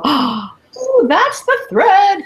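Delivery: high, slow "aha" with a sudden lift; excited discovery. Retold or imitated. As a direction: sound like you just found the missing piece. Finding the thread in a puzzle.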